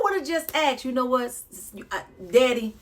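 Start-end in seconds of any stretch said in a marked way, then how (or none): none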